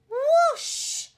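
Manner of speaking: very high swooping whoosh. A narrator's reading. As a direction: swoop up like flying through air. Catapult launch.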